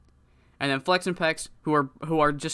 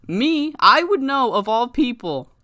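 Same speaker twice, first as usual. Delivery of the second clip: high, loud and emphatic; raised voice, slowed for emphasis; loud wrestler promo; 9 semitones higher, 7 dB louder, slower than usual. Acted. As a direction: punch each word out to a hostile crowd. Acted wrestler's mic promo.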